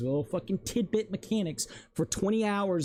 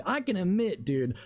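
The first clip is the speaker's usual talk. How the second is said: normal talking voice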